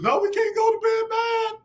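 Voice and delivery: mock his younger self, high and whiny; whiny high-pitched mock of old self. Mocking his old self arguing at bedtime, high pleading whine. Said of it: "I used to be one of them dudes".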